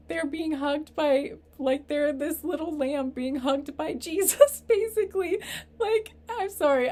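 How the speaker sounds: high, loud, laughing through tears; real tearful voice. Real, not acted. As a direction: push the words out while crying, half laughing. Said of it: "I'm tearing up right now".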